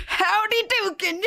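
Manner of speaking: high, slow hammed-up "Howdy dokin'"; mock ad catchphrase. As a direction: ham up the catchphrase high and drawn out. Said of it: "Like, it was".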